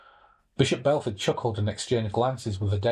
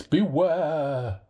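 crying out; 5 semitones higher than usual. A narrator's reading. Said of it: "cried the voice".

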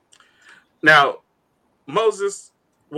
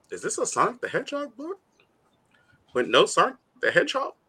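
hushed, questioning lilt rising at the ends; puzzled reader; 5 semitones higher, 8 dB quieter than usual. Retold or imitated. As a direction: puzzle over it softly, half laughing by the end. Retelling own confusion while reading.

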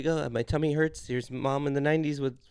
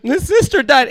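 much higher and louder psychic reveal; mock psychic; 11 semitones higher, 14 dB louder, slower than usual. Psychic revealing gossip to a caller, high and loud. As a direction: belt the twist like a TV psychic. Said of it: "It's like".

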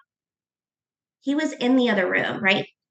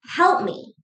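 emotional plea; 8 semitones higher, much slower than usual. Real, not acted. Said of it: "I'm gonna get a little emotional".

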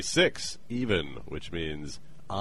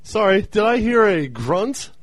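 character voice; 14 semitones higher, 8 dB louder than usual. Acted. O'Neill character voice, loud.

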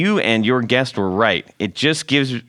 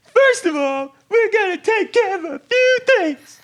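much higher rock-frontman stage voice. Shown as a demonstration. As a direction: belt it like a glam rock frontman. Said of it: "I sounded like [name]".

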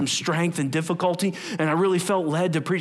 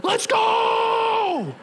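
hype shout. Acted. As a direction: fire them up like a coach. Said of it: "the Holy Spirit's like".

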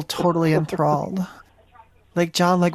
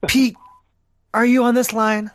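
yelling; 2 semitones higher than usual. Pretend. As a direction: shout for him across the house. Wife yells for husband.

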